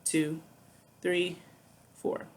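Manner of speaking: steady four-beat count-in; measured count before a demonstration. Shown as a demonstration. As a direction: count off evenly, a gap after each number. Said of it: "I'm gonna count off first".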